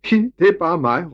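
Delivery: put-on high, loud, giggly little girl; calling out. A narrator's reading. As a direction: shout it gleefully from the top of a flagpole. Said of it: "råbte hun".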